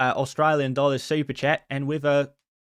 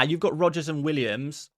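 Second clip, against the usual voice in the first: usual speaking voice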